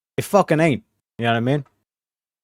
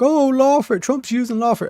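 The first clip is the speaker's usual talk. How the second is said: high mocking whine; squeaky sneering imitation of critics; high-pitched parody of whining opponents; 11 semitones higher than usual.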